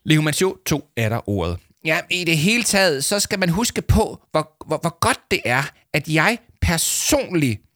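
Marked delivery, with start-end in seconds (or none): 1.8-7.6 higher-pitched pompous show-off; self-congratulating story character; self-satisfied boaster. Acted. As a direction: brag proudly, stretching the final cheer. Leo resumes his boasting.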